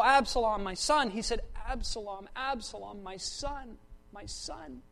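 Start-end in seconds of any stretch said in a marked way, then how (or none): 1.7-4.8 hushed grief, fading away on "my son"; doubled lament. Shown as a demonstration. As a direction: repeat each name softly, like a mourning father. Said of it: "the way you emphasize something is by doubling".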